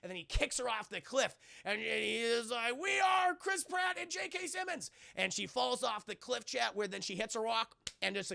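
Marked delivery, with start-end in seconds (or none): 2.8-4.9 high hammed-up victory yell; mock-epic triumphant call; triumphant shout. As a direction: declare victory heroically. Triumphant line after kicking monster.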